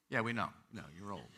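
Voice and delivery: low, very soft aside; dry mock agreement. Pastor answering a member who says he's old, dry aside. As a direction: drop the voice for a teasing aside.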